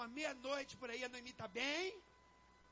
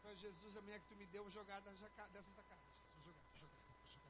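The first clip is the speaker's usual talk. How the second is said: very low, soft muttering to oneself; muttered angry prayer; 6 semitones lower, 14 dB quieter than usual. Acted. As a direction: grumble a threat under the breath to Jesus.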